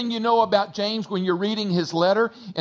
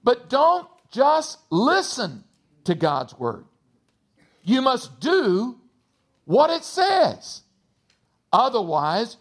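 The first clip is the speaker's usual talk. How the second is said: higher, measured reading of scripture; 5 semitones higher, slower than usual. Acted. Pastor reading James to the church, measured and solemn. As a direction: read the verses with weight.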